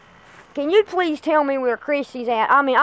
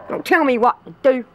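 ordinary talk

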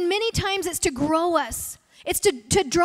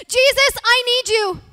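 a sudden high, loud cry of Jesus, then easing down; desperate prayer; 6 semitones higher, 6 dB louder, slower than usual. Retold or imitated. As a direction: cry out to Jesus like a friend at the end of her rope. Friend's desperate morning prayer retold.

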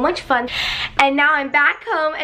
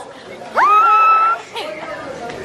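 high squealing laugh. Real, not acted. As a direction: burst out in a shrieking laugh. Delighted at the fries.

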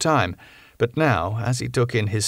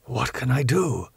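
ordinary delivery

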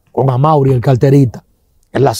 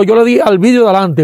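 ordinary talk

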